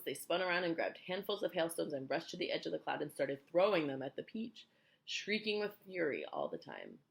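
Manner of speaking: plain reading voice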